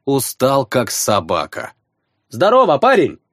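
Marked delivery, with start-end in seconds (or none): 2.3-3.2 loud cheerful greeting; shout. Acted. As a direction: call out to the boy. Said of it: "крикнул я".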